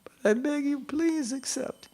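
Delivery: high, tearful begging, slowing at the end; Prabhupada crying. Retold or imitated. Imitating a guru begging Krishna to accept his offering, crying. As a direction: imitate a high, breaking plea. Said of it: "And Prabhupada is crying".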